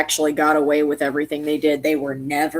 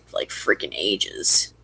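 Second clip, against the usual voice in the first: whispering; 9 semitones lower, 6 dB quieter, slower than usual. Real, not acted. Drops to whisper.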